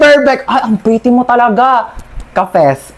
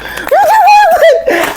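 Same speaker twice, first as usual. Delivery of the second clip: high cackle. Acted. Tita laughing at own joke.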